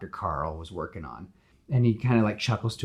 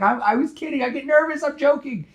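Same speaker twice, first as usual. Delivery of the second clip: much higher, nervous protest; high flustered excuse; 7 dB louder than usual. Retold or imitated. Peter defends his joke to angry Gwen, high flustered. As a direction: squeak out the excuse, panicking.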